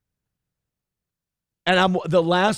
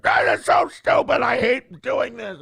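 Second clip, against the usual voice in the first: mock whine; 7 semitones higher than usual. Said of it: "now he's like".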